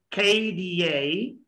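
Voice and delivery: slow K D A as "khay-dee-yay"; drawn-out K D A for copying; say after me. Shown as a demonstration. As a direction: stretch K D A with a puffed K. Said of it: "try to say after me".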